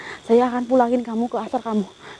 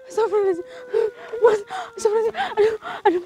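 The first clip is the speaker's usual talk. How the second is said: high, tearful complaining; half-laughing, half-crying over a sore foot; whimpering in pain; 10 semitones higher than usual. Acted. Ghost hunter whines to his viewers that his foot was stepped on, high and tearful.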